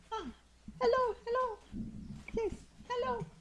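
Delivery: high, thin ancient voice; frail old creature greeting softly; very old faint voice. Acted.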